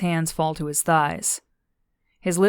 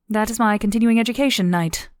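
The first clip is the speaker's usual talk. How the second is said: higher-pitched, prim refusal; a higher character voice, firmly declining; lightly voiced Zandy; 5 semitones higher, 4 dB louder than usual. A narrator's reading. Zandy explaining to Daniel, higher-pitched firm refusal.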